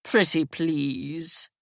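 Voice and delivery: slow, pouty pleading; exaggerated pout. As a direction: drag it out like a sulking child. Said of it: "with an exaggerated pout".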